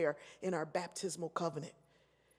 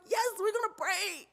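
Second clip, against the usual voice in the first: much higher and louder, eager churchgoer; excited; 12 semitones higher, 5 dB louder than usual. Retold or imitated. Speaker shows the church how she answers, high and eager. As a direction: burst out with eager agreement. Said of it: "You probably don't look like me".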